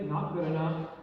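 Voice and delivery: low dad voice, dismissive; gruffly acted father's refusal; disapproving dad. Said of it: "He's like".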